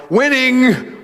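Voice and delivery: high, loud mock triumph; high, loud winning cheer; triumphant. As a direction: crow the word like a win. Triumphant one word.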